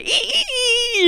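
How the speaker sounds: quick high squeaks; piercing repeated "ee"; imitated cutting noise. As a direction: squeal like the blade digging in. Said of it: "And so they".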